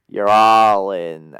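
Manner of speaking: low, loud, drawn-out villain line; Stephen Lang's character. Acted. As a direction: boom it slowly, dropping deep. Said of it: "Stephen Lang's character goes".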